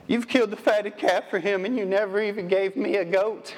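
high, whining mock complaint of a jealous son; whiny son's complaint. Preacher mocks the older son complaining to his father, high whining voice. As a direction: whine it like a sulking older brother. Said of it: "this is my favorite line".

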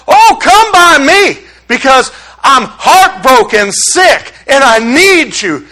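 high, drawn-out pleading refrain; impassioned preaching. Real, not acted. Preacher pleading with the Savior to come by, fervently. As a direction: preach it as a fervent cry to God.

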